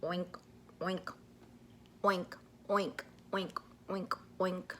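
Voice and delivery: quiet, half-whispered oink oink; low, quiet whispered pig oinks; soft piggy oinks. Shown as a demonstration. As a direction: oink softly like a little pig. He goes oink.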